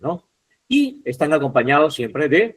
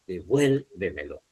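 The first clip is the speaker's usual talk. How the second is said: "devuélvemelo" slowly split, de-vuél-ve-me-lo, lower and softer; syllable by syllable; 6 semitones lower, 7 dB quieter than usual. Shown as a demonstration. Teacher shows students how to find the stress, syllable by syllable. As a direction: sound out "devuélvemelo" slowly in parts. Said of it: "Deben silabear".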